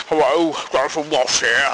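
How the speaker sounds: lightly done Ross impression that slides toward David Bellamy; a clumsy celebrity impression; bad Jonathan Ross. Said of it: "that wasn't really very good".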